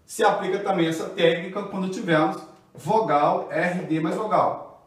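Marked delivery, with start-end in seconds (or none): none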